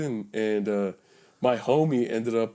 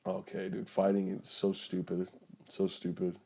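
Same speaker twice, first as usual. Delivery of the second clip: quiet; 5 semitones lower, 11 dB quieter than usual. Retold or imitated. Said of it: "the time where I'm like".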